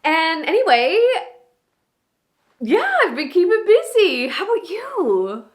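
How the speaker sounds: gushing, excited and proud, pitch leaping high; Maura, high on life. Acted. Monologue actor as Maura catching up with Danny, high on life. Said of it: "so high on life".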